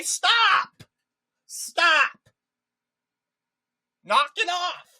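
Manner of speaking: put-on loud, high, fed-up shouts at a noisy kid; yelling stop. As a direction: shout him down, really fed up. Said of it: "So you say".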